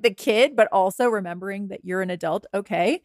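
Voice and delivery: ordinary talk, nothing marked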